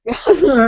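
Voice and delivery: bright burst of laughter; laughing. Real, not acted. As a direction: laugh out loud. Laughs at own surfer joke.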